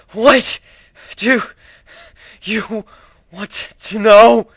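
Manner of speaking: high, loud, broken-up pleading; forcing words out between gasps of pain; gasping in pain. Acted. Tortured changeling gasping out an answer, terrified. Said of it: "breathing heavily between his screams".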